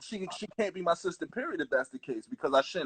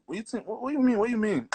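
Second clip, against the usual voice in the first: mock confusion; 10 semitones higher than usual. Acting out his confused reply.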